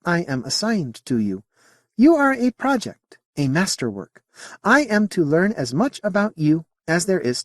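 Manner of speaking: high, lilting alien voice; a curious alien, politely puzzled; alien Modi voice. Acted. Alien answering Sally's question in a high voice.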